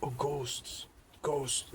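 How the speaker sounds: mock spooked child voice; imitating scared little girl. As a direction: stretch the ghosts like a frightened kid. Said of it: "she was saying it kinda like that".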